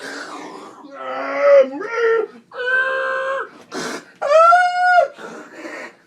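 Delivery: loud, high drawn-out screams; high screeching with laughter; wild shrieking noises. Pretend. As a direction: shriek nonsense noises until you crack up.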